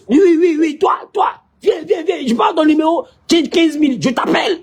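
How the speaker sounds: put-on a show-off shouting to a girl, high and eager; excited calling over. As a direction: wave her over eagerly, then a promise.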